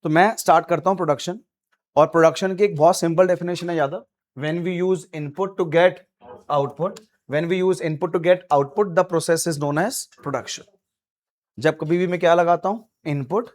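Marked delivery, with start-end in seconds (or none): 4.4-10.6 very slow, word by word, so students can write; slow dictation. Shown as a demonstration. Teacher dictating the definition to students, very slow. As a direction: dictate the definition slowly, spacing each word.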